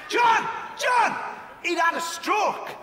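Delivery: high shouted interruption; mock indignant shout. Heckler shouting another detail about dad at John, high. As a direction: cry out the name, then insist.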